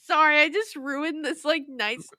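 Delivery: loud, breaking into laughter; laughing apology. Real, not acted. Host apologising for ruining the moment, laughing. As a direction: apologise through a fit of laughter.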